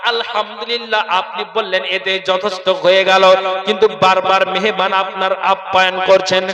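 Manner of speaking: loud, sing-song sermon chant; preaching. Acted.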